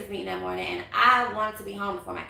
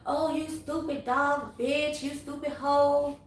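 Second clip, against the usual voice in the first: slow, mocking read of his texts; slow mock-angry quote; mocking ex's insults; 4 semitones higher, slower than usual. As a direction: sneer it out like his message.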